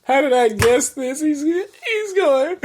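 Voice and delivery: loud, high, helpless laughing delivery; cracking up. Real, not acted. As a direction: barely get it out through the laughter. Helpless laughter while retelling.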